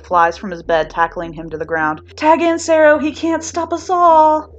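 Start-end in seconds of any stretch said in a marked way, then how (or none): 2.2-4.5 high, loud, slowed call for backup; loud excited cry to a friend across the room; excited shout. Acted. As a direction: yell for a teammate to tag in. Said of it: "shouting excitedly".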